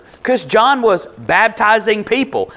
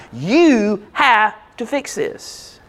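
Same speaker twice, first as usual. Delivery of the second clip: slow, word-by-word demand; insistent demand; 2 semitones higher, much slower than usual. Acted. Disciple demands John act, slow and insistent. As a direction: stretch each word, leaning on YOU. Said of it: "yelling and screaming and being like".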